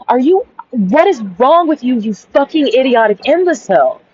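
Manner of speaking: mock-furious insult thrown at him; exasperated retort. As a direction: snap back in disbelief at his nonsense. Her retorting to the rice complaint.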